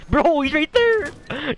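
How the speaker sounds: high, slow excited yell; panicking gamer. Real, not acted. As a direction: shout the warning. Spotting enemy mid-fight.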